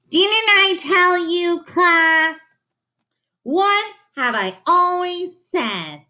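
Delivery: high, slow scolding teacher voice; stern teacher. A narrator's reading. Health teacher scolding Tina before the class, high and slow. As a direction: lecture the class with pointed emphasis.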